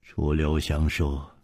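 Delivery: usual speaking voice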